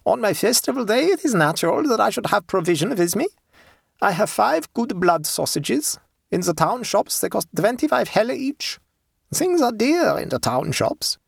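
put-on high German-accented peasant woman; unhurried high voice, German accent; German dialect. A narrator's reading. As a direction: play a German-speaking countrywoman, pitched high. Woman describes her provisions.

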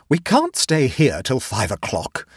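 ordinary delivery

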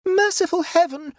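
very high-pitched wail of dismay; horrified wail. Acted. Nora realises what the boy shot, wailing. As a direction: wail it in horror. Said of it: "she wailed".

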